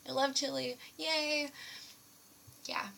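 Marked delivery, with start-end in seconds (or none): 0.1-1.5 excited. Retold or imitated. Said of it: "I was like".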